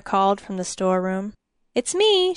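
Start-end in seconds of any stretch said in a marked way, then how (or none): 1.7-2.3 bright call pitched much higher; quick excited high reply; cried out. A narrator's reading. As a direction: call out eagerly from the doorway. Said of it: "she cried".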